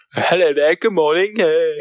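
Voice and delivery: high-pitched put-on British greeting; mock British accent. Showing off a British accent to a friend, high and hammed-up. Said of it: "how's my British accent".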